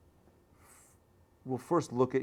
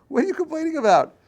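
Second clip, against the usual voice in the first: loud, high, laughing disbelief; scolding that breaks into a laugh, loud and high; laughing rebuke; 12 dB louder than usual. Acted. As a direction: laugh at the absurd complaint.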